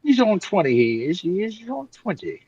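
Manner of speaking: hammed-up Cockney accent with a mild lift. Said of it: "What is that accent?".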